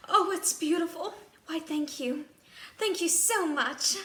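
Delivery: high, loud, thrilled thanks; delighted exclaim. Acted. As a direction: gush with delight. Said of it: "I exclaimed".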